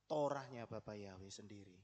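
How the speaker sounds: normal talking voice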